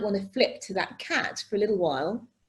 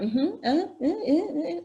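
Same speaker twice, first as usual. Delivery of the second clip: higher-pitched eager mm-hmms and uhs; imitated listening sounds; 7 semitones higher, slower than usual. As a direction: mimic an over-responsive phone listener. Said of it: "they're listening like".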